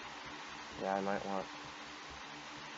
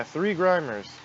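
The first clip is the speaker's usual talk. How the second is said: normal talking voice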